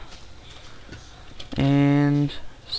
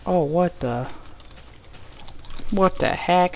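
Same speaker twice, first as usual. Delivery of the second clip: usual speaking voice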